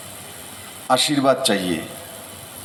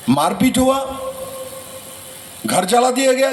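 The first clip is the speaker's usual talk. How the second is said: rising indignant oratory; 7 semitones higher than usual. Real, not acted. Speaker demands of the crowd why decades of fighting happened, in rising indignant oratory. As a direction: pour out rising anger at the violence. Rally speech, outrage at decades of violence.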